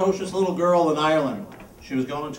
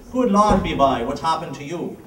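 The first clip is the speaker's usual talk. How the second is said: Irish bartender; 5 dB louder than usual. Acted. Bartender shocked, Irish accent joke.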